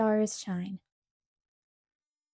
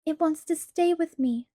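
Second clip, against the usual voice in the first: put-on higher, childlike pleading; begging; 7 semitones higher than usual. A narrator's reading. Laura begs Pa to keep the baby, high pleading. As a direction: coax Pa with a child's plea. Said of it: "Laura begged".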